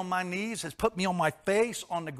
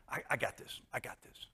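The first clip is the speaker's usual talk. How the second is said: whispered self-talk; 9 dB quieter than usual. Retold or imitated. Proud man insisting he's fine.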